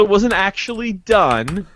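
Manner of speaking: usual speaking voice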